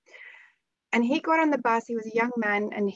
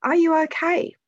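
worried father asking; 7 semitones higher, 4 dB louder than usual. Retold or imitated. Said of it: "his father asked him".

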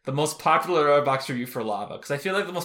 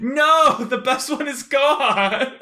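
mock despair; 10 semitones higher, 5 dB louder, much slower than usual. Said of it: "And I was like".

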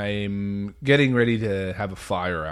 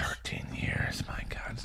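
whispered; 13 dB quieter, slower than usual. Real, not acted. Stunned at contract length.

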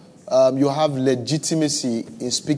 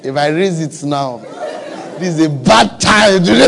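laughing mock self-talk; 4 semitones higher, 8 dB louder than usual.

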